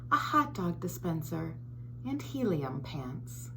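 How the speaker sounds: put-on lively storybook read-aloud for kids; reading to children. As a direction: read it like story time for little ones. Reads picture book to children.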